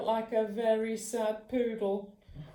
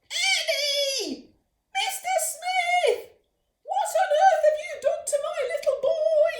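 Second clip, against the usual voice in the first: high, loud, shrieking panic; horrified mum shout; 9 dB louder than usual. Acted. Mum shrieking at Mister Smith over Ernie's haircut. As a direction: shriek at the barber in horror.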